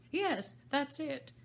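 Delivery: slow, high, sighing Hatter; weary hatter sighing; sighing reply. Acted. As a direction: sigh it out wearily. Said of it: "with a sigh".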